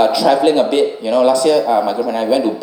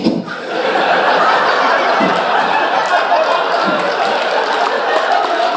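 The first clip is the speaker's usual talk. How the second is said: breathy laugh. Real, not acted. Long laugh after the punchline.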